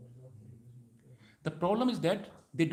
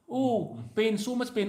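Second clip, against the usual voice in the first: higher-pitched mock cry of pain; weeping child; 5 semitones higher, slower than usual. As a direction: lightly act a child complaining of pain. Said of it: "Child will weep".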